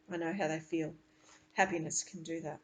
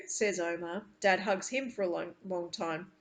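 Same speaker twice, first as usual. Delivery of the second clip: usual speaking voice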